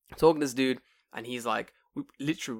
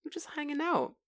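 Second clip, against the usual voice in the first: higher, softer squeaky excuse; mock defensive guy; 10 semitones higher, 6 dB quieter than usual. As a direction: imitate the guy brushing it off. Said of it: "'Cause he's like".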